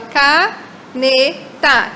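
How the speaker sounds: caneta said slowly, each syllable clear, falling at the end; slow demo word. Shown as a demonstration. Teacher modelling the original word for the kids, slowly. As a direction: spell out caneta slowly in sound, ca-ne-ta. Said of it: "Essa é a importância".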